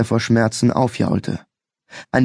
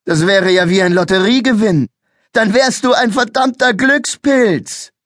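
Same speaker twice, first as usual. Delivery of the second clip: much higher, louder scornful taunt; mocking; 10 semitones higher, 4 dB louder than usual. A narrator's reading. Policeman taunting the boy as a lucky devil, scornful. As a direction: taunt him, slowing at the end.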